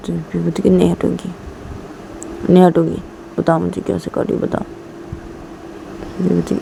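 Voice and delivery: ordinary delivery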